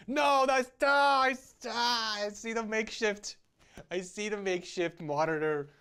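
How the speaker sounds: high, loud startled yell that settles; excited shout. Real, not acted. Reviewer spotting a makeshift monitor riser, excited shout. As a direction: blurt it out as the discovery hits.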